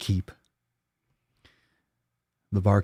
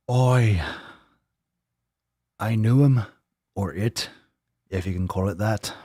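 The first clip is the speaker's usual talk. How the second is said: Irish pirate. Acted. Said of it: "turning Irish and pirate".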